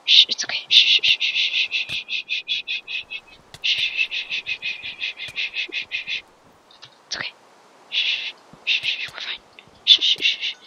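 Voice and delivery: put-on whispered teasing hush, as if calming a baby; mock soothing shushing. Player teasing an opponent, mock-soothing whisper.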